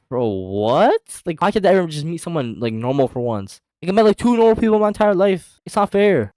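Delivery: high, loud mock whine of protest; acted-out exasperated outburst; exasperated complaint. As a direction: protest how unfair it is, pitch climbing. Exasperated outburst after awkward reply.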